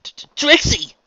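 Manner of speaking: high, loud startled stammer; stuttered exclamation. A narrator's reading. Pony cries out to Trixie, exclaiming. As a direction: blurt the name out after two stuck starts. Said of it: "she exclaimed".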